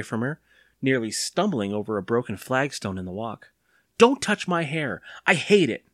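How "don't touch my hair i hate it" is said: put-on angry boy. A narrator's reading. Boy angrily pulls away from mother.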